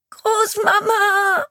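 high, loud, drawn-out scream; screaming child. Acted. A terrified girl clinging to the police chief, screaming for her grandmother. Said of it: "Schreiend klammert sie sich".

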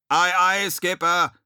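loud, high acknowledging shout; calling back. A narrator's reading. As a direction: holler back down from the bridge. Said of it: "hallte es von der Brücke herunter".

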